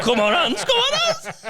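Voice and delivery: giggling mock encouragement; laughing cheer. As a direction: cheer him on while cracking up. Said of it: "I'm like".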